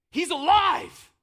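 loud, slow, high triumphant shout; Jesus proclaiming the miracle; joyful proclamation. Acted. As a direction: announce it with joy. Jesus proclaiming Lazarus alive.

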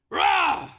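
loud high animal roar; growl. Shown as a demonstration. Pastor leading the congregation in a growl, loud. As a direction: roar it out like an angry beast. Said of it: "can everybody growl with me".

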